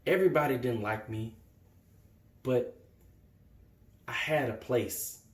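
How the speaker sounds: low, soft, halting confession; quiet hurt. Acted. Young man remembering his refuge at Drew's, quiet hurt.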